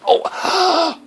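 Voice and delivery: sharp high yelp of pain; pained cry. Acted. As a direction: cry out as if hurt. Acting stepping on a nail.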